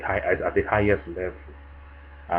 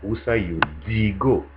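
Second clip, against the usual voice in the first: Cameroonian pidgin, a touch higher and louder; casual Cameroonian pidgin question; 3 semitones higher, 5 dB louder than usual. Shown as a demonstration. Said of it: "With us Cameroonians, we say".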